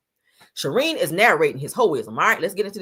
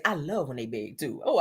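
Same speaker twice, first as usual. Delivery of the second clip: normal talking voice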